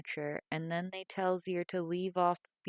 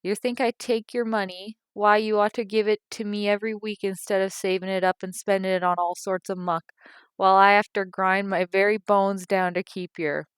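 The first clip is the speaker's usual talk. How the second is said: loud, high, indignant tirade; angry rant; 3 semitones higher, 9 dB louder than usual. A narrator's reading. Mother ranting about money, loud and indignant. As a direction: pile on the grievances.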